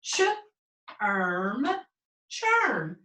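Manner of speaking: segment ch, then erm, then blend into churn; sounding out a word. Shown as a demonstration. Teacher to class, sounds out churn slowly.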